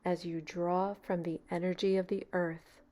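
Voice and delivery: calm, even meditation voice with long pauses; guided meditation. Real, not acted. Meditation leader easing a beginner into relaxation, calm and unhurried. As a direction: guide them gently into stillness, leaving space between phrases.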